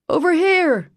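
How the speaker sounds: loud, high shout; yelling. Acted. Searcher spots the child, yells to the crowd. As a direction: call the searchers over. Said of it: "someone yelled".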